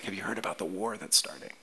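low, soft voice of a traveler passing on news; acted hushed news-bearer; hushed rumor. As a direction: pass it on quietly, confidentially.

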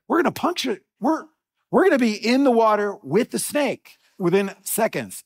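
high, loud mock alarm; hammed-up panicky outburst; retold panic. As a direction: act out rising dread at imminent disaster. Retold panic, snake under the raft.

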